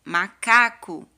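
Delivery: macaco drawn out slowly and clearly; teacher reading macaco slowly; slow model word. Shown as a demonstration. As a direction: stretch macaco for kids to follow. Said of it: "vamos ler com tia cada palavrinha?".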